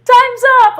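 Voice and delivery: high laughing call; yelling across the room. Real, not acted. Calling to husband in another room.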